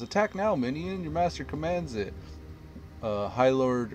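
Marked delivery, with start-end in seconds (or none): 0.0-2.1 higher, raised villain command; mock yell. Lich commanding his minion, raised mock yell. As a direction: bark orders at a minion. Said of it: "Thalazan Dustbringer yells".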